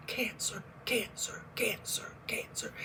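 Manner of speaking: cancer, cancer whispered, fading to nothing; whispered echo. Retold or imitated. Scene: the word cancer echoing in her head, whispered. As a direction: breathe cancer like a haunting echo. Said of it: "I heard it five times".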